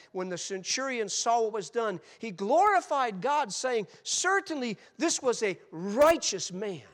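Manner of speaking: loud, slow, rising sermon voice; preacher thundering the centurion's praise; full-force preaching. Acted. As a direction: preach it out with fire.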